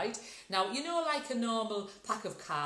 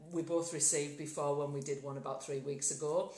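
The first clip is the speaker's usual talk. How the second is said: ordinary delivery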